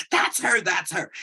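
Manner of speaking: put-on a kid starstruck, tugging her sister; excited whisper-shout. Kid spotting star, dragging sister.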